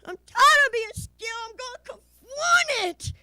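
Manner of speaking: high, loud defiant cry; fed up. Acted. Kid working up nerve in the hall, fed-up high shout. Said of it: "fed up".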